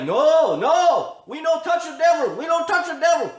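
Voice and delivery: high, mock German accent insisting. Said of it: "They got a little German accent".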